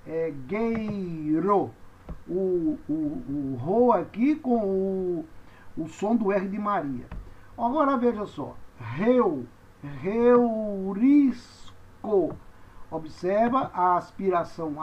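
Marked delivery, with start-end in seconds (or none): 0.1-1.7 long-held syllables in "gueiro"; slow model for learners to copy; pronunciation demo. Shown as a demonstration. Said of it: "Então, você diz é gueiro". 8.8-11.7 "reurisco" drawn out syllable by syllable; breathy English-h start on "reurisco"; slow model for learners; pronunciation demo. Shown as a demonstration. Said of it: "você pronuncia então reu".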